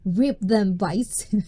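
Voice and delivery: usual speaking voice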